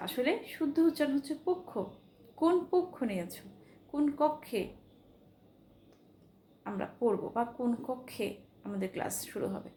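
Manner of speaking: ordinary talk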